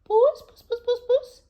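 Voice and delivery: high, loud coaxing call to a cat. A narrator's reading. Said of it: "calling out".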